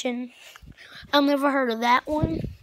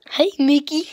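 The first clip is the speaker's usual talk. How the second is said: dopey chuckle, then loud greeting; Goofy; 3 semitones higher, 8 dB louder than usual. Acted. Vlogger proving both characters share one voice, Goofy impression. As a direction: chuckle and hail a pal in a goofy voice. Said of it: "It's the same voice".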